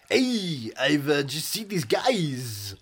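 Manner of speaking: slow, drawled American film-star voice; lazy, slow Travolta drawl, stretched out; John Travolta impression. Retelling Travolta's phone call, asked to do the accent: slow drawl. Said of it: "do you want to do the accent?".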